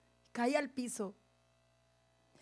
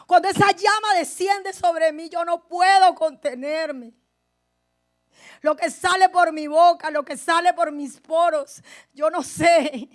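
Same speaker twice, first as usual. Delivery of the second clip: fervent preaching; 3 semitones higher, 6 dB louder than usual. Acted. Testimony rising into preaching.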